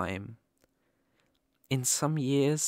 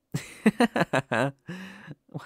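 a higher, louder burst of laughter; happy laugh; 6 semitones higher, 5 dB louder than usual. Acted. Laughing because the puppy finally smiled. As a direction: laugh out with delight. Listener smiles after being teased.

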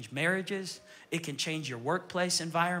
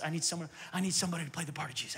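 quick whisper; 3 semitones lower, 5 dB quieter, much faster than usual. Real, not acted. Asides calling for volunteer.

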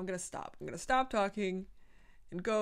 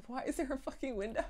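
normal talking voice

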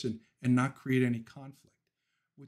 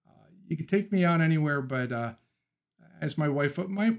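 usual speaking voice

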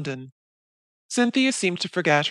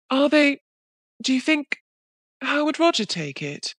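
high, hesitant, timid question; Molly timidly asking Cynthia about Roger; 10 semitones higher than usual. Acted. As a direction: ask as if afraid of the answer. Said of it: "asked Molly very timidly".

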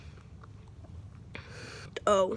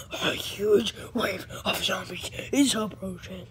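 ominous put-on announcement voice, a bit lower; mock-ominous game warning; game announcer; 3 semitones lower than usual. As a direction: warn of doom like a video game. Mimics game's zombie wave announcement.

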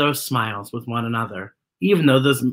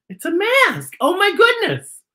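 high and delighted over the picture; squealing delight, much higher; excited gasp. Real, not acted. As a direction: coo with wonder at the page. Reacting to a mask picture.

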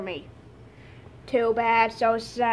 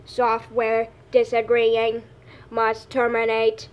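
slow, menacing robot voice; 4 dB louder, slower than usual. Pretend. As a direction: announce it like a cold machine. Kid plays a robot.

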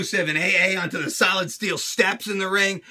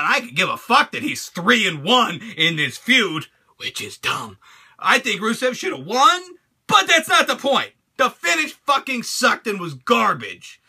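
ordinary talk, nothing marked

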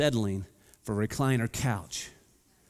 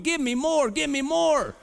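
much higher, louder, greedy plea; delighted high plea for more; begging for more; 13 semitones higher, 3 dB louder than usual. Retold or imitated. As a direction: beg like a kid who wants more. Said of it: "I'm going".

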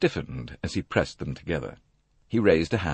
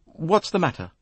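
put-on higher, irritable young woman; ungracious; 11 semitones higher than usual. A narrator's reading. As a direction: demand it rudely. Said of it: "she said ungraciously".

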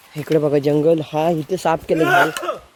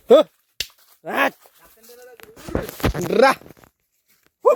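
calling out; 6 semitones higher, slower than usual. Real, not acted. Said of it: "आवाज देत आलो".

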